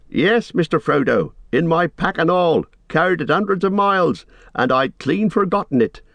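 high-pitched, eager admission; excited reply. A narrator's reading. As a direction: call down, thrilled and a little abashed. Sam excitedly answering about forgotten rope.

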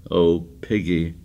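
a subdued, stricken appeal to Piggy; low and stricken. A narrator's reading. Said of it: "low and stricken".

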